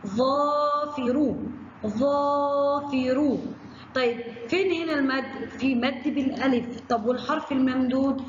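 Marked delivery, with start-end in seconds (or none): 0.1-3.4 ظافر sung out slowly, vowel held; slow sing-song ظافر; slow stretched word. Shown as a demonstration. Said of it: "زي كلمة إيه؟".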